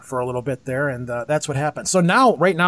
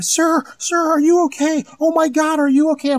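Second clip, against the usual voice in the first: frantic, much higher woman's voice, repeating the question; freaking out woman; 11 semitones higher than usual. Retold or imitated. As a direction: imitate a panicking bystander, high and pleading. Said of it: "the one behind me is just freaking".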